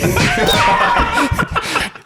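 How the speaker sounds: airy unvoiced laugh; breathy laugh. Real, not acted. Joking about friend's mom, airy laughing. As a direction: laugh off the joke.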